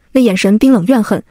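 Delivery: ordinary delivery, nothing marked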